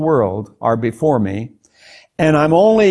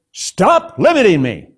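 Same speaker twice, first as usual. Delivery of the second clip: a sudden firm, higher-pitched rebuke; stern rebuke; 5 semitones higher than usual. Retold or imitated. God rebukes the traveling preacher in his room, retold as a stern rebuke. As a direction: retell a voice sternly correcting you. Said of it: "like you really rebuked me".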